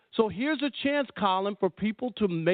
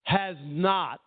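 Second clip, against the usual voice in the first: usual speaking voice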